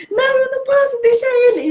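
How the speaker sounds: high, pleading voice as if crying; tearful pleading. Retold or imitated. Daughter pleading with her father while crying. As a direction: beg him to stay, voice cracking high.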